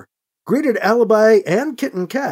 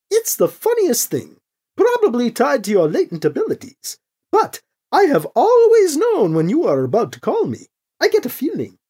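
high, lilting character voice; chatty Krish character voice; 7 semitones higher than usual. Acted. Krish explaining a hunch to Alibi, high and lilting. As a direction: keep it high, with eager swoops.

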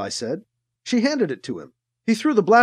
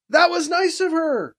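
shouting back; 10 semitones higher than usual. A narrator's reading. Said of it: "Alibi shouted back".

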